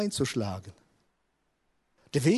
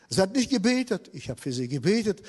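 normal talking voice

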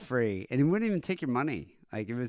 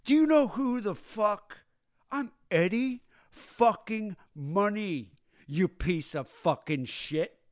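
slow, much higher mock-drunk ranting; drunken rage; 9 semitones higher, slower than usual. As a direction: drag out each word like a wasted rock star. Drunk rocker rants at bartender.

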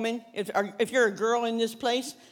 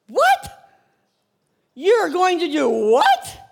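high, loud cry of disbelief; shocked disbelief; 5 semitones higher, 7 dB louder, slower than usual. Acted. Rizpah to the king's men about her sons, shocked cry. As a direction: gasp it out as a horrified mother. Rizpah reacts to sons' death.

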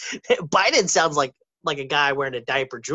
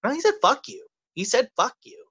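high, insistent retelling; emphatic insistence; 9 semitones higher than usual. Real, not acted. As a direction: stress that he really said it. Insisting on what Biden said.

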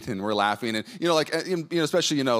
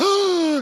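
loud, high mock gasp; sharp, exaggerated intake of breath; shocked gasp; 6 dB louder than usual. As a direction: imitate a wife's appalled gasp. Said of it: "she, like, gasped".

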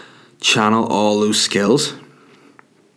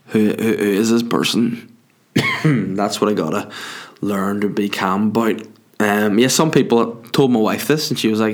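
normal talking voice

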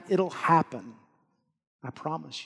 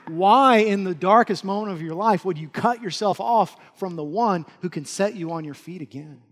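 normal talking voice

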